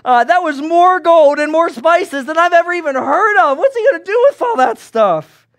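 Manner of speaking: high, loud, amazed chatter about the gifts; excited gossip. Acted. A townsman marvels at the king's gifts, high and loud. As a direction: gush over the treasure like a wide-eyed townsman. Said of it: "Then another responds".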